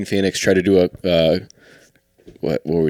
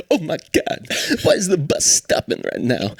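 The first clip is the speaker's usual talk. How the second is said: high-pitched celebrity impression; hammed-up, pitched-up astonishment; John Travolta; 12 semitones higher than usual. Comedian playing a star on a bus, hammed-up impression. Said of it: "John Travolta. And he said".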